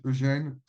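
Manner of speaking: "Eugène" said the French way, ending stressed on "gène"; "Eugène" pronounced as in French; French pronunciation. Shown as a demonstration. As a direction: give "Eugène" its French sound, oo-ZHEN, no English "Yoo-jeen". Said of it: "So I use the French, uh, way to tell you your names".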